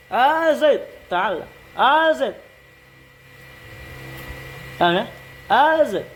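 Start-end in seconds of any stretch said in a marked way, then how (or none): 0.1-1.5 high calling "A Zaid" across the room. Shown as a demonstration. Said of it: "contohnya A". 1.8-2.3 high calling "A Zaid" across the room. Shown as a demonstration. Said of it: "contohnya A". 5.5-6.1 high calling "A Zaid" across the room. Shown as a demonstration. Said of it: "contohnya A".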